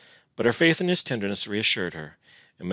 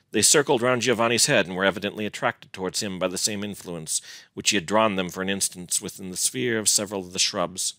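plain reading voice

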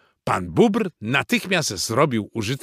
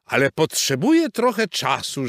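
higher-pitched horse's call; 6 semitones higher, 3 dB louder than usual. A narrator's reading. Horse Maurycy asking friends for more time, calling out. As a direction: call back to the waiting group. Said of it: "krzyknął ze stajni".

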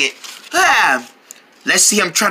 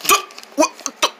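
ordinary talk

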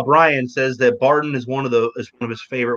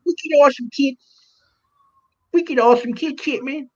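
high, put-on Boston voice; imitating Mike's Boston; 14 semitones higher than usual. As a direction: parrot the Boston slang in a squeaky voice. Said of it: "Just talk like Mike for the entire thing".

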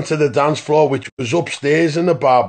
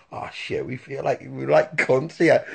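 embarrassed whisper to yourself; whispered thought; 3 semitones lower, 5 dB quieter than usual. Retold or imitated. Doorman realising he's a laughing stock, whispered. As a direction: breathe it out, mortified and soft. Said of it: "And I thought".

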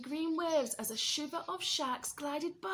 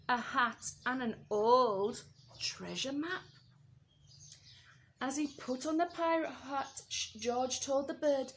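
plain reading voice

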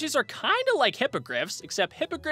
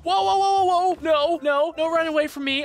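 calling out; 6 semitones higher, 5 dB louder than usual. Real, not acted. Hippogriff runs off.

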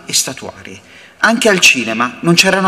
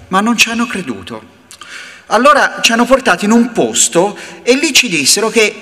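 ordinary delivery

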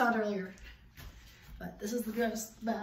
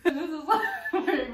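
laughing; 6 semitones higher, 6 dB louder than usual. Pretend. Laughing repeat of reveal line.

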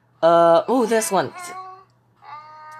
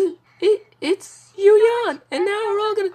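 laughing. Real, not acted. Laughing at the yawn clip.

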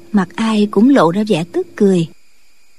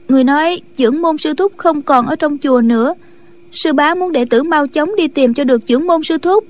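higher-pitched, earnest disciple voice; disciple reporting; 5 semitones higher than usual. Acted. Disciple tells her leader of their search, higher-pitched. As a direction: report it like an eager junior.

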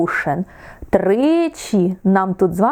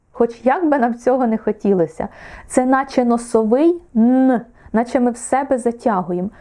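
ordinary talk, nothing marked

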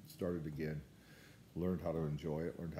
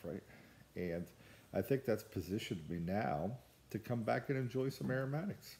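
ordinary talk, nothing marked

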